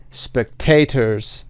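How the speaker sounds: "spectators" slowly, with -ta- stressed; stress on middle syllable. Shown as a demonstration. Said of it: "قسمت وسط هست".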